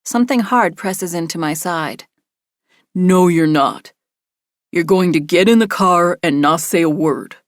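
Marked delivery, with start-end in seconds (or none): 2.9-7.4 cold, firm order from a captor; menacing threat. A narrator's reading. Kidnapper threatens narrator with gun.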